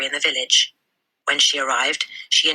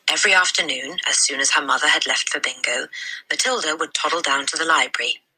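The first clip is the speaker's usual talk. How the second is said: ordinary delivery, nothing marked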